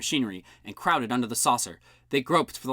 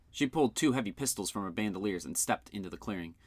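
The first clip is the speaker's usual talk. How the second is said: plain reading voice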